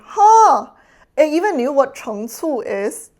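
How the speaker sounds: a sharp, high, loud huh of surprise; surprised huh. Real, not acted. Streamer surprised the bot knew the word, loud huh. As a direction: caught off guard, blurt it out.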